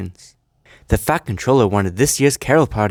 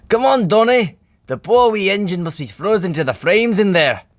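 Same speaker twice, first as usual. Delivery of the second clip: plain reading voice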